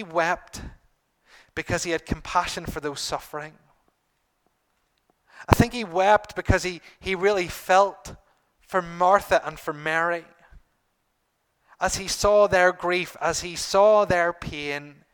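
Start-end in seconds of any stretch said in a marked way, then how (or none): 5.5-15.0 fervent preaching. Real, not acted. Preacher builds to fervent emphasis.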